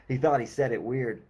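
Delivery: plain reading voice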